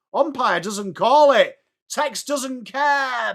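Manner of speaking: lifted, drawn out at the end; excited play call. Real, not acted.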